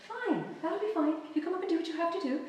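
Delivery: high, quick sugary agreement; fake-nice wife. Wife forcing herself to be nice to her husband, mock-sweet and high. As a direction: sweetly pretend it's all fine. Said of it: "I was like".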